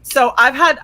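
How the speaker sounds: normal talking voice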